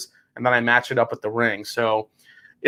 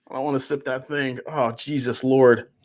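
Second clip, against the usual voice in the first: ordinary talk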